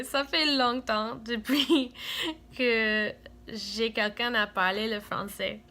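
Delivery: ordinary talk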